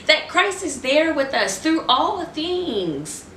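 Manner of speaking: high and slow, speaking through a laugh; laughing through words. Real, not acted. Preacher still thrilled after her cry, laughing as she speaks. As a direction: say it while still giggling with joy.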